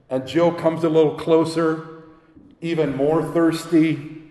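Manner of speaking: usual speaking voice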